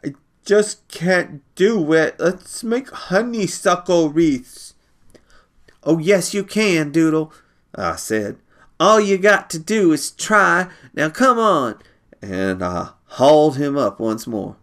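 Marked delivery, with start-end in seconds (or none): 0.0-4.7 put-on high, slow, tired child voice; defeated small child. Doodle giving up. 5.8-11.8 insistent urging. Acted. Brother urging Doodle on.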